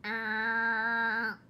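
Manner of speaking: a loud buzzing "Ah"; short harsh buzz sound; buzzer imitation. Shown as a demonstration. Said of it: "I think it's like".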